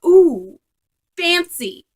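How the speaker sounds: high, loud "Ooh, fancy"; mock impressed. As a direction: act dazzled by something posh, playfully. Mock reaction to kombucha.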